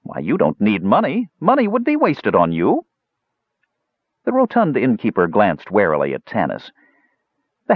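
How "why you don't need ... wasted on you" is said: glib patter, pitch climbing high; hurrying on. A narrator's reading. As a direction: keep talking so he can't object. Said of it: "He hurried on, not giving Otik a chance".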